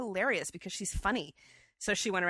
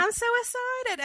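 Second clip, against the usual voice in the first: high, slow and louder Savannah gush; Savannah accent; 5 dB louder than usual. Retold or imitated. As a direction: stretch it out high like a thrilled Southern lady. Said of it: "the Savannah accent is very specific".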